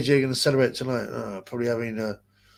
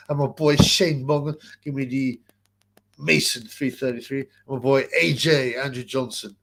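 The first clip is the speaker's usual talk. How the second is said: ordinary delivery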